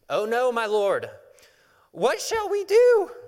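loud, high-pitched panicked wail; freaking out. A narrator's reading. Servant cries to his master on seeing the army, panicked. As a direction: cry it out in hammed-up panic, stretching the last words. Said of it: "He's freaking out".

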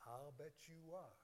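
low, hushed aside; quiet retort. Acted. Pastor answering the objector, quiet sly retort. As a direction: drop it right down under your breath.

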